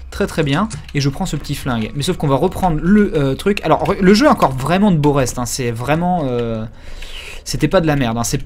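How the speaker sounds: ordinary talk, nothing marked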